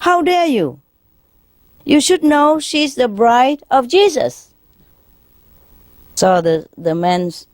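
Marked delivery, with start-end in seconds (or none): 0.1-4.5 high-pitched, scolding outrage; affronted nun, much higher in pitch; indignant. Acted. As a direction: put him in his place, voice raised high. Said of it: "the nun was very indignant".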